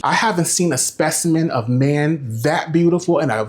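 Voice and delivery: normal talking voice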